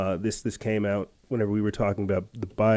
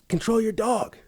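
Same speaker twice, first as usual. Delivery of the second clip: mocking the hiker; 11 semitones higher than usual. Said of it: "And, uh".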